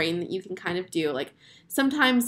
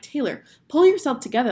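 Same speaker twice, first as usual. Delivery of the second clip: higher, louder mock telling-off; self-scolding; 5 semitones higher, 6 dB louder than usual. Speaker telling herself to stop crying, scolding. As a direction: give yourself an exasperated talking-to.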